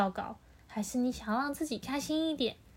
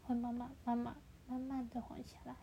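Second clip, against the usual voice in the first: slowing down; 12 dB quieter than usual. Shown as a demonstration. Showing the child the heartbeat calming, slowing down.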